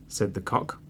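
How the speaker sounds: ordinary delivery, nothing marked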